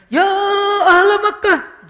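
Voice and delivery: loud, high, slow preacher's shout; khatib's shouted call. Shown as a demonstration. Preacher crying out to the crowd, loud and strained. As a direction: belt it out like a pulpit preacher. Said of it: "hadis ini berubah nadanya".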